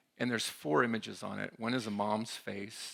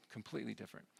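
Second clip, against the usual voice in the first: "completely different" repeated much softer, fading; quiet echo; 11 dB quieter, much faster than usual. Real, not acted. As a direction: let the words "completely different" drop away into a soft echo.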